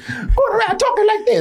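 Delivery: high put-on squeaky voice, mocking; mimicking the octopus's put-on voice. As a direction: lift into a high fake voice to mock the octopus. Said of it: "I know ain't your real voice".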